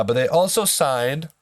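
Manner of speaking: ordinary delivery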